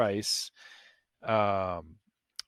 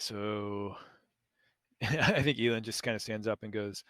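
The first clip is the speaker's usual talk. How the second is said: ordinary talk, nothing marked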